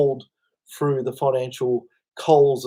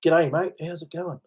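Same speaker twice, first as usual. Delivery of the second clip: Australian greeting; 3 semitones higher than usual. Shown as a demonstration.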